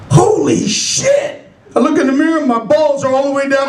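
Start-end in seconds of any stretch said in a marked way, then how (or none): 0.1-1.4 high, drawn-out shock; acted slow-dawning alarm; shocked exclamation. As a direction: stretch it out in stunned disbelief. Shocked discovery in bathroom mirror.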